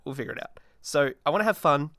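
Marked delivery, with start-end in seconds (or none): 0.0-0.6 much softer mumbled repeat; a hushed afterthought; quiet echo. Shown as a demonstration. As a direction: drop it to a murmur.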